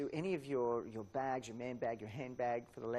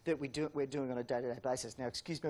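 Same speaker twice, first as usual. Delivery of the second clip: ordinary talk, nothing marked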